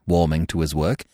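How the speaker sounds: usual speaking voice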